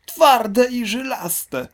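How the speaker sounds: high, slow, loud mocking outcry; outraged exclamation. Big crocodile mocking the small one's words, loud and slow. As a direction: shout it back in disbelief. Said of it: "wykrzyknął olbrzymi".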